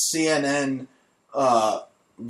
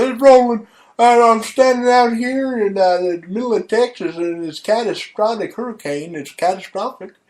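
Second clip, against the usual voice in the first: mock storm reporter; 8 semitones higher, 5 dB louder than usual. Host mocking a storm reporter in a hurricane, high and loud. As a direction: ham up a reporter shouting through a hurricane. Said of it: "He should be out here in the middle of this hurricane broadcasting".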